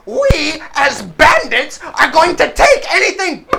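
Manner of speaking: high, loud, deliberate declaration; proclaiming kobold bandit. Acted. Bandit declaring the robbery to the party, pompous high shout.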